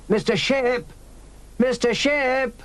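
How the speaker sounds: high, long call across the yard; child calling a name, drawn out. A narrator's reading. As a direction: call for Mister Ship from afar. Robin calling out.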